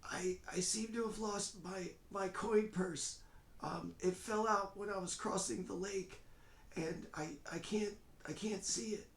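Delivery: put-on high, halting frail old man. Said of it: "this kind of frail voice".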